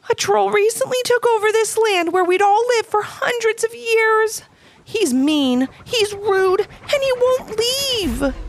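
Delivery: high, bright small critter voice. A narrator's reading. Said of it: "explained Charlie".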